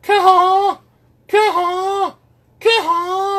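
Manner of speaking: very high, loud goose "Caw" calls; spit moan. Shown as a demonstration. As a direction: honk it out like a goose. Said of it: "Starts tongue up".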